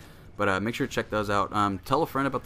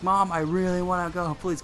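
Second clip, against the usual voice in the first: put-on higher, whiny child asking please; pleading kid; 8 semitones higher than usual. Boy pleading again with his mom for the trip, whiny. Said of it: "and I was like".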